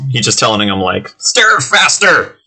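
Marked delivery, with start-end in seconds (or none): 1.2-2.4 high, drawn-out goblin yell; screechy goblin head-chef command; shouting goblin. Acted. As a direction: order the kitchen about as a goblin chef. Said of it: "he's, like, pointing at him and shouting".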